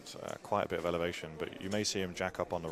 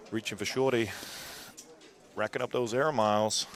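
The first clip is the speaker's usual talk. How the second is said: loud, higher mock American accent, hammed up; 4 semitones higher, 6 dB louder than usual. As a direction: play a brash American commentator voice, higher and louder. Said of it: "That's my best American accent".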